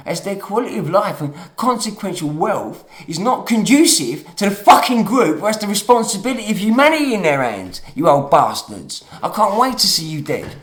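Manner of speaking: angry rant, loud and heated; getting emotional. Real, not acted. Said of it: "Sorry, I'm getting emotional again".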